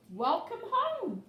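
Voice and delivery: high, loud, slow greeting; cheerful welcome. A narrator's reading. Narwhal friends greeting Kelp back home, high bright welcome. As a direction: sing it out to a returning friend.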